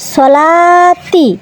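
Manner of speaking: সলাতি very slow, the long লা stretched; slow drawn-out recitation. Shown as a demonstration. Teacher reciting সলাতি slowly to the class. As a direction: stretch the লা in সলাতি for one alif. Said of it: "teacher joins syllables into word".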